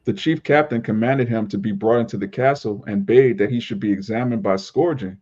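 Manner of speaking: ordinary talk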